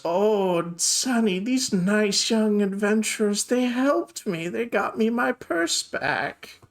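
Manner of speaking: high, slow granny voice; sweet old lady. Acted. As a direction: tell your son proudly, high and drawn out. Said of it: "And she goes".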